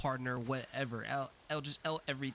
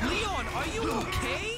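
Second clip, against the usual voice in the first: high, loud cry of alarm; alarmed shout; 6 dB louder than usual. Real, not acted. Streamer calling to Leon in the game, alarmed shout. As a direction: call out in real alarm, drawn out.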